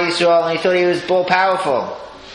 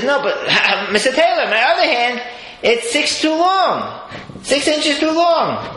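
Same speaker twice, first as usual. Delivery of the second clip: high, pleading complaint voice; whiny groom; 10 semitones higher, slower than usual. Retold or imitated. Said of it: "He said".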